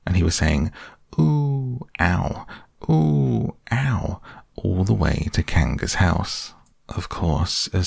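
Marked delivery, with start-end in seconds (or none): 1.2-4.2 squeaky ooh and ow; piglet voice. A narrator's reading. Piglet bouncing along.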